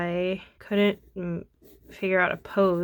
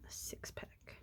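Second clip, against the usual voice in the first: whisper; 16 dB quieter than usual. Real, not acted. Muttering to self while drawing.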